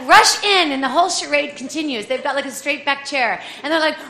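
ordinary delivery

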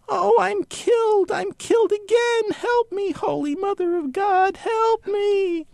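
very high, slow wailing cry for help; dying bird wail. Acted. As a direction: shriek it from the carcass as you flee. Voice thrown into bird carcass.

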